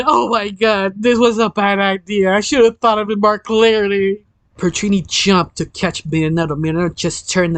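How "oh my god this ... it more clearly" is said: mild, higher-pitched panic; acted mock scream of dismay; panicked scream. A narrator's reading. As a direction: wail regretfully without really shouting. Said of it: "just screamed".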